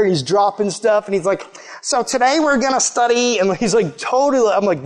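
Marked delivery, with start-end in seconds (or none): 1.8-3.4 higher, dorky lecturer impression; nerdy professor. Preacher imitates his professor opening the lesson, nerdy professor voice. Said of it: "he talked like this the whole time". 4.0-4.5 higher mock professor voice; nerdy professor. Preacher imitates his odd college professor, nerdy professor voice. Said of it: "he talked like this the whole time".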